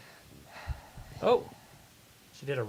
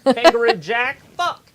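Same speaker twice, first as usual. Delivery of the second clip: high, loud, fast hammed-up Aussie; over-the-top Aussie parody; mock Australian accent; 13 semitones higher, 18 dB louder than usual. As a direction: bellow a cartoon Aussie cliché. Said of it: "be Australian".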